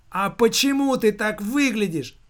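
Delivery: high, agitated cry; shouted question. A narrator's reading. Mother challenges son about his looks, crying out. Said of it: "прокричала она".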